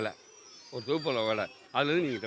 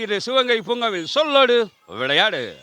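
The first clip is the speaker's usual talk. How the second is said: high, projected announcer greeting; show host opening; 6 semitones higher, 5 dB louder than usual. Real, not acted. Host welcomes viewers to the park word game, announcer voice. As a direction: welcome the audience with big, ringing energy.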